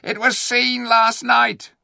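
high, loud, slow scream. A narrator's reading. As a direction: scream it at the crowd. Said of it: "screamed the ape".

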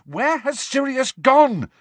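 very high, loud, slow yell. Acted. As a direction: shout after the departing elf. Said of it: "Harry yelled after the elf".

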